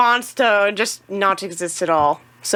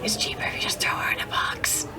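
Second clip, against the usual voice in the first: unvoiced, much softer whisper; 10 dB quieter than usual. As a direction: whisper it as a sly aside. Whispered aside.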